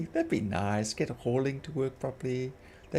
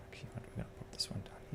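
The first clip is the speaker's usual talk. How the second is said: whispering; 16 dB quieter, faster than usual. Real, not acted. Quiet aside while playing.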